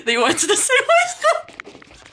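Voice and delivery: high, squealing laughing voice; overcome with laughter. Real, not acted. Streamer reading absurd fanfic tags to chat, helplessly laughing. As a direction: read on while cracking up.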